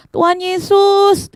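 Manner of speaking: high, long-drawn call; berteriak memanggil. Acted. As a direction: call out persistently over the crowd. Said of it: "Bartimeus tetap teriak adik-adik". (seruan panjang bernada tinggi)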